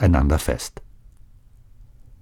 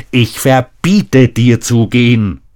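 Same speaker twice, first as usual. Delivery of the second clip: higher, louder forbidding; father forbids his daughter to leave, raised voice; angry command; 7 semitones higher, 6 dB louder than usual. Acted. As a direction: bark the order at her.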